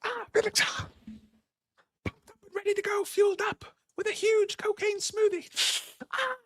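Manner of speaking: very high squeaky cartoon dog voice; squeaky hyper pup, pitched very high; Felix as a dog. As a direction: yap it out like a wired little dog. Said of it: "You should get Felix on here as dog".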